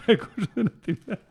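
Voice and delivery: reading through laughter; laughing. Real, not acted. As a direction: try to read it but keep laughing.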